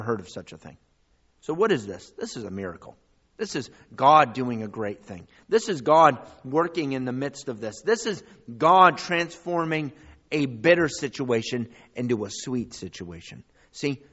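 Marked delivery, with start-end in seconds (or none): 3.4-13.4 loud, driving preacher's build; sermon climax, loud; full-force preaching. Acted. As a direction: preach it out to the congregation. Preaching crescendo on God's miracle.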